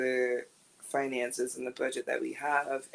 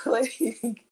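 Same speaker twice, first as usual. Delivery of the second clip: ordinary talk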